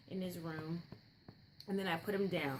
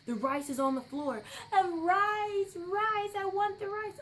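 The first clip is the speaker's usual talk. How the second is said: put-on high, loud crying whine of a tired two-year-old; mock toddler tantrum, much higher and louder; imitating toddler crying; 12 semitones higher, 6 dB louder than usual. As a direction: wail it like an overtired toddler begging for rice. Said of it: "He's like".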